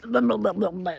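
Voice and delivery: rapid sputtering babble like a stalling engine; babbled engine splutter; stumbling car noise. Shown as a demonstration. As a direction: imitate a juddering car about to cut out. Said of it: "if you get that stumble".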